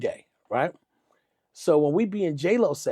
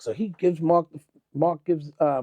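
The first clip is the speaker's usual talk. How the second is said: usual speaking voice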